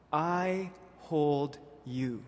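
very slow and hushed; tender. Acted. Preacher voicing God comforting the beloved, tender. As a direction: hold each word like an embrace. God's voice, slowed repeat.

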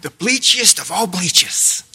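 hyped ad pitch; infomercial voice. Pretend. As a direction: sell it like a TV ad. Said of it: "Sounds like an infomercial".

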